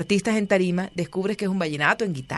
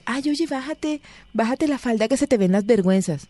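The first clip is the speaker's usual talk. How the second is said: a mild prim Bogotá cachaco voice, pitched up; 6 semitones higher than usual. Retold or imitated. Mock Bogotá man scolding her friend about the skirt, prim and high. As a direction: fuss like a prissy Bogotá guy. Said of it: "sale un cachaco ahí".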